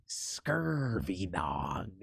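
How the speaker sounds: very slow, low pirate snarl; pirate insult. Shown as a demonstration. Sample pirate insult, snarled very slowly. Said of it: "just things that pirates say".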